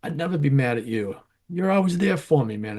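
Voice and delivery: lightly voiced close friend insisting he'd never be mad; friend's reply. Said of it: "he goes".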